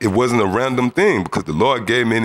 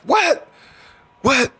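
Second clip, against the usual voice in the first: much higher, shocked double question; stunned disbelief. Retold or imitated. Cellmates reacting to news he's released, shocked high cry. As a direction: cellmates can't believe it, pitch jumps up.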